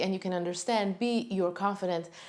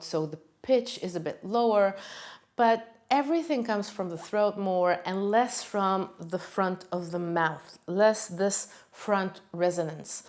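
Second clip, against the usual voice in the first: ordinary talk, nothing marked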